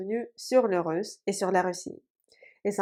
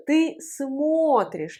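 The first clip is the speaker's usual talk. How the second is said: смотришь slowly, stressed on a full о; 4 semitones higher, much slower than usual. Shown as a demonstration. Teacher showing the moved accent, смотришь stressed on о. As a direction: stretch the о in смотришь. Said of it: "l'accent sur le O".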